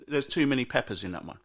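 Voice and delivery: ordinary talk, nothing marked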